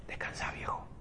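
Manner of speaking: soft unvoiced whisper to a dying father; whispered farewell. Son whispering goodbye to his old father. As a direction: breathe it out without voice, tenderly.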